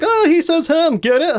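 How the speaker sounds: high, jeering tease with a fake laugh; mocking jeer. Imitating people who mock how they say him, high-pitched jeering. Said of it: "they're mean jerks".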